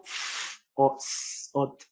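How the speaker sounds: "f o s o t" as soft, slow, voiceless hisses without vowels; consonants only. Shown as a demonstration. Lecturer shows consonants carry little volume, soft hiss. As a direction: breathe out "f o s o t" quietly, consonant by consonant. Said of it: "si hago".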